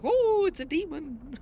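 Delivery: high, slow, hammed-up wail; mock panicked people. Streamer mocking reactions to anything popular, hammed-up panic. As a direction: draw out a silly high mock alarm. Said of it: "Every time something gets popular".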